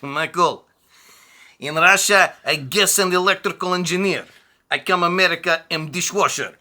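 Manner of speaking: mock Azerbaijani immigrant complaining in broken English; Roman's Russian accent. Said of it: "So he'd be like".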